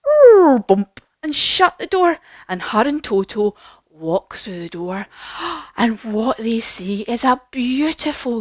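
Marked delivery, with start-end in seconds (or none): 0.1-1.0 high, loud falling whirr into a bump; loud, high swoop, then bump; imitated falling noise. Imitates the house falling and landing. 5.1-5.7 soft storyteller's gasp; quick, awed intake of breath; gasp of wonder. Acted. Sees the beautiful land.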